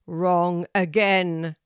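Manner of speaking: loud, slow verdict; booming tree teacher. A narrator's reading. Oak tree turns Kelty down, booming. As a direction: boom it out as the oak. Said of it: "she heard the teacher of the oak tree reply".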